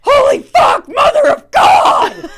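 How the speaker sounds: full-force high scream of agony; drawn-out, pain-struck shriek; retold scream. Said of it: "arching up and screaming".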